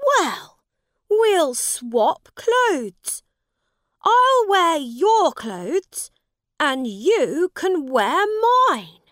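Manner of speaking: loud, high, drawn-out delivery; excited prince with a plan; eager young prince. Acted. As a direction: propose it boldly, high and slow. Prince proposes swapping clothes.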